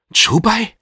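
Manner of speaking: incredulous, high rising question; ungläubig. A narrator's reading. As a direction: echo the name in disbelief, pitch shooting up. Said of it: "fragte Dutch Shag ungläubig". (ungläubig nachgefragt)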